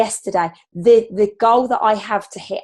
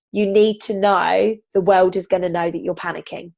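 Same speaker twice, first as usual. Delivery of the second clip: ordinary delivery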